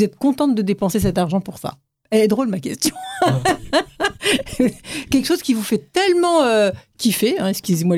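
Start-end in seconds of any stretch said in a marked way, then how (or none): 3.0-5.0 high amused laugh. Real, not acted. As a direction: laugh at your own question. Host laughs at own question.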